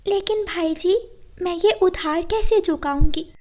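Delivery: a hint of a worried little sparrow; sparrow Rano. Sparrow Rano asks the bird about the loan, lightly worried.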